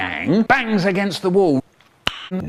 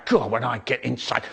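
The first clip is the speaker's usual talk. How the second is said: usual speaking voice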